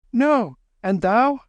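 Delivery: high, slow, loud answer; lightly voiced boy calling; Kim. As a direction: call back reassuringly.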